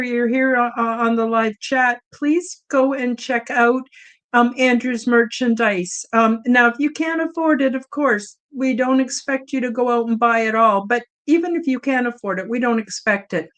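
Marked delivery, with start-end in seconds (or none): none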